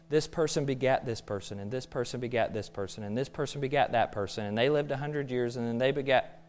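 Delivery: low, soft monotone list-reading; droning recital. Acted. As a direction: drone through it like a tedious list. Recites boring genealogy in dull drone.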